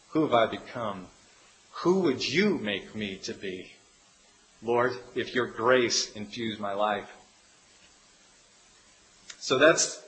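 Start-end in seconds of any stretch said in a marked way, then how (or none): 0.1-7.1 quiet prayer. Acted.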